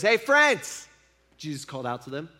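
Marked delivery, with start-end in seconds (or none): 0.0-0.9 louder, higher call across the water. Retold or imitated. Stranger on the shore calls to tired fishermen, higher and louder.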